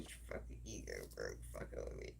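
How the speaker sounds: menacing whisper, fast at the end; threatening whisper. Acted. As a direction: warn them in a low whisper. Threatening the bullies.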